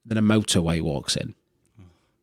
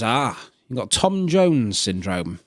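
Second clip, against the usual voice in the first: slow diagnosis; slower than usual. Acted.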